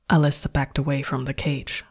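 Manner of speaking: plain reading voice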